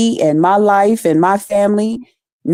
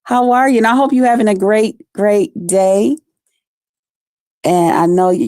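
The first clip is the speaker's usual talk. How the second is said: normal talking voice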